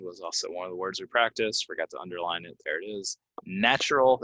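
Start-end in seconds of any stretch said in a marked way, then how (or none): 3.4-4.2 Natural stressed on the first syllable, higher. Shown as a demonstration. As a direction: punch the start of Natural. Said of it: "if it's an adjective".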